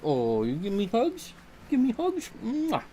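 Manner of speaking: high, soft you're so needy cooed at the dog. Real, not acted. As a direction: coo at the needy puppy. Baby talk to pet.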